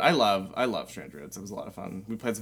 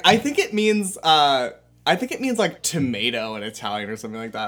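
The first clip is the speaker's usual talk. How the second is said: imitating friend; 4 semitones higher, 7 dB louder than usual. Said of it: "He was like".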